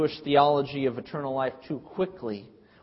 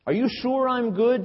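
pitched-up, probing disbelief; challenging Jesus; 8 semitones higher, 4 dB louder than usual. Retold or imitated. Jesus pressing the rich young ruler, high and challenging. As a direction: push the man to rethink, raised and pointed. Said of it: "Jesus asks".

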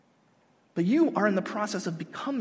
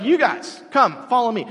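brisk, raised call to a group; calling out a command; 4 semitones higher, 5 dB louder than usual. Retold or imitated. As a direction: summon them in short, louder words. Jesus calling fishermen to follow.

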